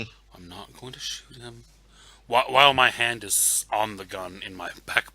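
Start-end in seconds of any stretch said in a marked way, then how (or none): 0.0-1.6 very soft muttered self-restraint; hushed mantra, talking yourself down; under the breath. Acted. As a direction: repeat it to yourself, barely audible. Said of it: "I am repeating under my breath".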